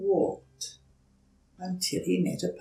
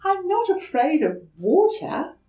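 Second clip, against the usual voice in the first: put-on high, hesitant small-child voice; young turtle politely asking a stranger for help; timid little turtle; 12 semitones higher, 6 dB louder than usual. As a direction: ask shyly, a little scared. Franklin replies.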